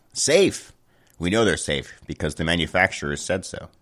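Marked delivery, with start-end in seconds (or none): 0.1-0.7 sarcastic. Acted. Mocking the briefing's word safe.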